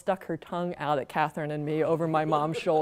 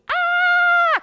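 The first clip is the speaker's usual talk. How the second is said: shrill high shriek, full force; piercing child's scream; terrified scream; 7 dB louder than usual. Acted. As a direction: scream like a kid who saw a werewolf. Paula screaming while running.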